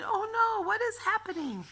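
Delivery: very high hammed-up panic; mock alarm. Grandma voicing startled people in the windows, mock panic. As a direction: squeal it like startled townsfolk. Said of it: "looking out the window saying".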